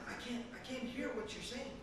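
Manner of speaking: soft, feeble protest; dying old man. Acted. Dying uncle unable to hear his nephew, weakly. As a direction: answer quietly, as if strength is fading. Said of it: "an old man who's already dying".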